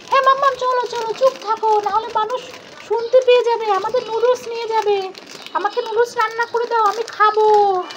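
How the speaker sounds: put-on lightly high, hushed kid voice; a kid plotting a secret; conspiring child.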